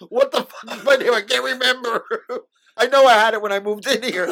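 mock confused. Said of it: "You'd be like".

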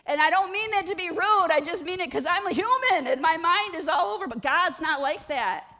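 loud, high preaching build; full-force preaching. Acted. Preacher pleading to the congregation, loud and high. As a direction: preach it out with passion.

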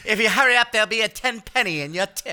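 hammed-up 1940s dandy ordering; zoot suit guy impression. Friend mocks Ryan's zoot-suit phase as a dandy, hammed up.

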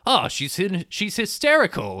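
brushing off the hysterical sister; mock Michael. Michael shrugging off his sister to Kay, mock dismissive. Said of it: "He's just like".

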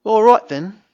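put-on British accent. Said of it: "he's saying your accent is, uh, okay".

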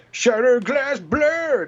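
slow, high, loud ad voice; kids commercial announcer. Shown as a demonstration. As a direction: announce it like a toy ad. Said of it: "kids commercial".

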